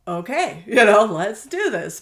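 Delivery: a loud laugh, then slow let's do this; laughing agreement. Retold or imitated. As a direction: laugh it off and commit. Retold agreeing to friend's plan.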